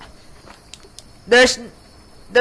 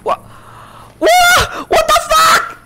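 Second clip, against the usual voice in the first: high, loud shocked yell; shocked shout; 4 dB louder, slower than usual. Real, not acted. Player finding his dog, shocked shout. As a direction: shriek it in sudden shock. Finds something shocking in game.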